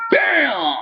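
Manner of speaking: high, punchy gunshot imitation; mock gunshot. Retelling Jax shooting, mock gunshot. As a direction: spit out the bang. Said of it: "pulls out his gun".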